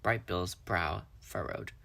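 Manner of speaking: ordinary delivery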